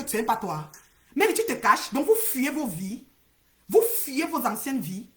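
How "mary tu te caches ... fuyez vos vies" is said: ordinary talk, nothing marked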